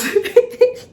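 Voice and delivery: a high, loud laugh that mimics a friend; squealing laugh imitating a friend; imitated laugh. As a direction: throw out a high-pitched cackle, imitating her. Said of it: "And Zita goes".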